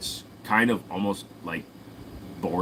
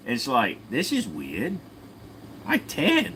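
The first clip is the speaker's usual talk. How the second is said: high, animated put-on Australian; Australian accent; 5 semitones higher than usual. Australian explains being shipped off for a biscuit, broad Australian accent. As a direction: tell it in broad, swooping Australian. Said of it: "argument between a British and Australian".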